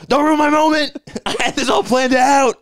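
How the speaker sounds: the killer screeching at the heroine; mock whiny yell. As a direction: throw a hammed-up whiny tantrum. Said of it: "And he's like".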